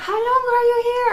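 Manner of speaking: high, eager mock question; excited question. Stranger excitedly asks the visitor, high imitated eagerness. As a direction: ask it like an overexcited stranger.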